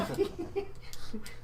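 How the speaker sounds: high-pitched, breathless laughing fit; helpless real laughter. Real, not acted. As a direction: crack up, unable to go on. Cracking up at own idea.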